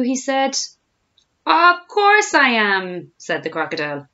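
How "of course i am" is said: high, loud, very drawn-out; gleeful boast. A narrator's reading. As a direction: bellow it slowly with relish. Crocodile gleefully confirms his plan.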